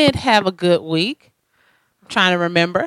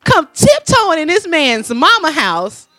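indignant rant; 6 semitones higher than usual. Retold or imitated. Upset sister rants about girl.

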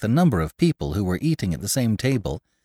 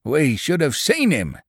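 growling; 5 semitones higher than usual. Acted. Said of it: "growled Farmer Goussot".

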